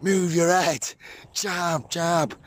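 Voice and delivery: imitating a cornerman's high-pitched urgent calls; boxing cornerman. Cornering a boxer at the fights, high imitated coaching calls. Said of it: "I was cornering twenty-five boxing fights".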